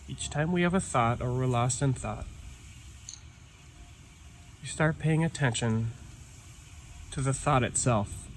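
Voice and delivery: calm meditation guide with long pauses; guided meditation. Real, not acted. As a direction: ease listeners inward, leaving silence between phrases. Leads a breathing meditation.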